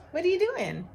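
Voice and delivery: high, loud coo to a pet; playful high-pitched question to an animal; pet talk. Real, not acted. Talking to her pet.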